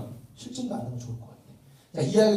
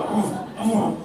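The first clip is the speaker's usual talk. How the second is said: higher, louder strained praise forced through clenched teeth; through gritted teeth; 6 semitones higher, 4 dB louder, slower than usual. Retold or imitated. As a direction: praise your son while biting down hard. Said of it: "이를 두 번 깨무는 거죠".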